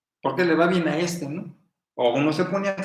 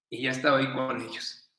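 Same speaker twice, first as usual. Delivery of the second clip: ordinary delivery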